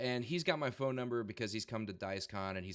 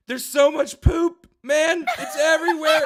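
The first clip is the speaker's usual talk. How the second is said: put-on very high, loud, drawn-out drunken panic; freaking out; 14 dB louder than usual. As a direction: wail it like a drunk friend seeing disaster. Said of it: "freaking out".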